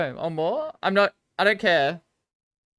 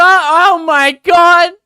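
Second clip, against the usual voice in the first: drawn-out, much higher and louder "oh my god"; exasperated shout; 9 semitones higher, 10 dB louder, much slower than usual. Real, not acted. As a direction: cry out in exasperation, stretching it. Exasperated at chat's wrong guess.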